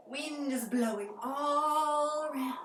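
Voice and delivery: drawn-out, breathy like the wind; slow airy wind voice. Shown as a demonstration. Teacher guiding kids through a forest, slow and airy. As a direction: let the words float slowly like wind.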